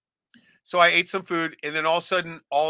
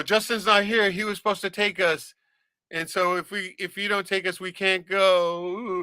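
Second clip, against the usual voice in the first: put-on pleading kid; 6 semitones higher than usual. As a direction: whine like a kid who needs a ride. Kids pleading, slow ending.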